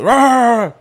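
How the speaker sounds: loud barking noises; mock dog bark; dog barking imitation. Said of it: "the dog goes".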